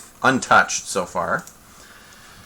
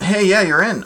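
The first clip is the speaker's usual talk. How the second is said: excited; 10 semitones higher, 5 dB louder than usual. Real, not acted. Greeting player joining call.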